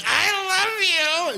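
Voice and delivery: very high, crying love squeal; over-the-top dog love. Retold or imitated. Owner hugging the dog's head, high mock sobbing. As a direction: squeeze out the words with mock tears. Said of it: "I was like".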